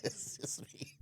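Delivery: very soft, slow whisper; pretend whisper. Joking about being alone in California, slow whisper. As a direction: whisper it slowly through a laugh. Whispered joke over friend's line.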